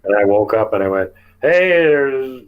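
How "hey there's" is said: higher, louder call that settles down; mock holler from the tent. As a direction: call out to unseen visitors, then ease off. Calls out to creatures from tent.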